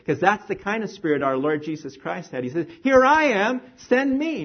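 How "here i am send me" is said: eager volunteering. Retold or imitated. Eager volunteer quote of Jesus.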